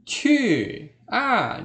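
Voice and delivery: QU, A high and falling; fourth tone. Shown as a demonstration. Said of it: "nada keempat". (QU, A nada keempat, jatuh)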